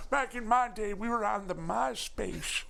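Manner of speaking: high geezer voice, stretching the ending; hammed-up high elderly voice; mock old-timer. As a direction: reminisce like a creaky cartoon oldster. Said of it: "Showing Your Age Via References Hour".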